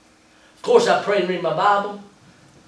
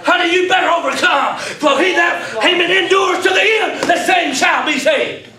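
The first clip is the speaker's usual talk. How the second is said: full-force preaching; 8 semitones higher than usual. Acted. Preacher exhorting the church to overcome, fiery shouting.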